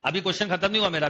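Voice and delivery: ordinary talk, nothing marked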